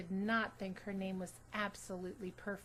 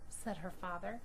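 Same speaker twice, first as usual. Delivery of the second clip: ordinary delivery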